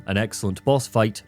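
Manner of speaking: normal talking voice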